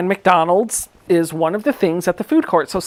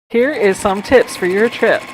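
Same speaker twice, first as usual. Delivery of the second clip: ordinary talk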